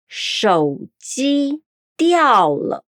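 syllable by syllable; 手机掉了 said slowly as shǒujī diào le; slow Mandarin demo. Shown as a demonstration. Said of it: "repetindo comigo".

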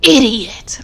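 retold yell. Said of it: "And she's like".

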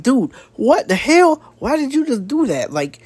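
high, stunned and indignant; the Blossom twins' outraged reply. Retold or imitated. Recapping the twins scolding Jughead, high and outraged. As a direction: voice the siblings asking if he's serious, pitched well up. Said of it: "And they're like".

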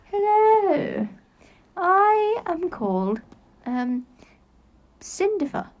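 high, slow posh character voice; hammed-up accent challenge voice; put-on accent. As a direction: introduce yourself in a silly high accent. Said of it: "shall we do an accent challenge".

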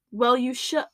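normal talking voice

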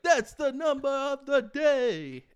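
loud, high, slow triumphant announcement; grand singsong cartoon vampire; the Count. Retelling the Count's finish for co-host, hammed-up Count voice. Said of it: "he would be like".

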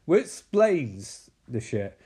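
normal talking voice

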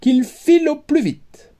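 high, sharp royal dismissal; scornful princess. A narrator's reading. Princess sending the suitor away, high and sharp. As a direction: shoo him out shrilly. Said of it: "s'écria la princesse".

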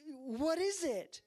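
high, slow, puzzled enthusiasm; drawn-out high-pitched wondering question; feigned excited curiosity. Retold or imitated. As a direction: imitate a dad trying to sound thrilled. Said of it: "trying to, like, get excited about it".

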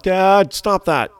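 put-on high, loud kid protest; whiny kid. Son telling dad to stop, whiny and loud. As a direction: imitate a kid whining at dad. Kid annoyed at dad's prank.

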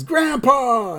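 high-pitched exclamation; imitated delighted greeting; Bart. Bart opening the door to his grandfather, mock excited cry. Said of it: "Bart opens the door and says".

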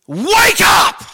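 sudden high, very loud shout; yelled wake-up call. Real, not acted. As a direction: jolt the whole room awake. Suddenly yells at the women.

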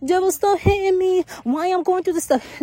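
high-pitched mock whining; hammed-up tearful complaint; mock crying. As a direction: imitate your old self crying and complaining. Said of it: "I used to ball up and cry".

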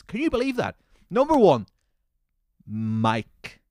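high-pitched, incredulous outrage; incredulous ranting, voice jumping high; outraged disbelief. Real, not acted. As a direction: pitch it right up in indignation. Outraged at the comment.

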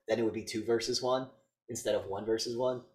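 ordinary talk, nothing marked

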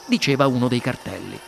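ordinary delivery, nothing marked

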